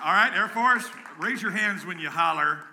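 loud high rally call. Real, not acted. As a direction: pump up the crowd. Calling Air Force to holler.

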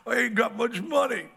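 high, whiny excuse; mock whine of his younger self; stingy young self. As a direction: plead poverty like a tightwad. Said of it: "I'm like".

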